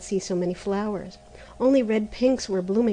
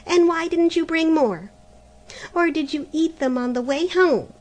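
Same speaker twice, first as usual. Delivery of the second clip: high, loud accusing questions; harsh; 8 semitones higher, 5 dB louder than usual. Acted. Helena harshly accusing her sister of eating apples. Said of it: "said Helena harshly".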